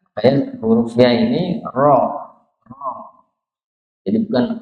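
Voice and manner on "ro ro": "ro" with a thin, brief tremble on r; light tapped r. Shown as a demonstration. Teacher showing takrir, lightly trembled "ro". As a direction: say "ro" with only a slight roll. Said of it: "bergetar tapi tipis".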